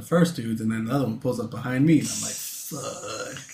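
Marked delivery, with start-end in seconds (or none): 2.3-3.4 hushed, drawn-out curse; softly imitating an oh-no moment; retold dismay. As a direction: let the word sink out quietly. Dismayed reaction as cop pulls up.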